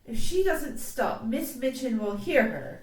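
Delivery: exclaimed schoolgirl warning; crying out. A narrator's reading. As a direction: call out a loud, urgent warning. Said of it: "cried Jessie".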